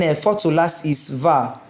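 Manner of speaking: usual speaking voice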